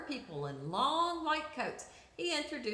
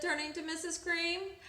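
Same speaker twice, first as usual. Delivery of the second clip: usual speaking voice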